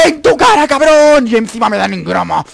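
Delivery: high, loud gloating yell; real exultant screaming; triumphant shout. Real, not acted. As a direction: roar the victory, easing at the end.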